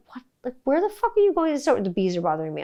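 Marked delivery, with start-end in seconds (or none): 0.1-1.6 much higher, exasperated demand; imitating her own disbelief; mock furious where are you going; incredulous outrage. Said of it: "I'm just like".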